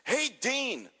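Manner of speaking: calling out. Retold or imitated. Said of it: "Perry Saturn comes walking by and he goes".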